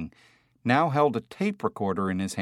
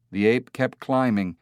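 usual speaking voice

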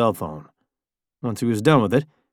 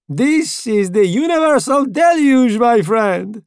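much higher, louder manic glee; gleeful shout; 12 semitones higher, 6 dB louder, slower than usual. A narrator's reading. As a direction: crow over the storm. Italian exults in the storm.

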